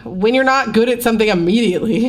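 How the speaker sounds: ordinary delivery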